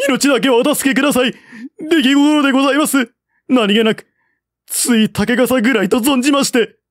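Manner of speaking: a terrified soldier groveling for mercy; pleading for his life. Acted. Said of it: "悲しみ訴えた". (命乞いする甲高い早口)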